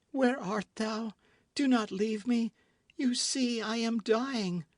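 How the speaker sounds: high, slow faint pleading; dying plea. A narrator's reading. Constance dying, pleading with D'Artagnan. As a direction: beg him to stay.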